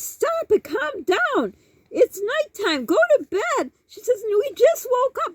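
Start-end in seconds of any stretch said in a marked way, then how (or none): 0.0-3.7 very high-pitched imitation of a scolding mother; exasperated scolding. Mother orders mom to bed. 4.3-5.3 high-pitched imitation of indignant insistence; protesting mom.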